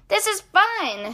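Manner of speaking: high slow happy cheer. A narrator's reading. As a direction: cheer with delight. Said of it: "Henry cheers".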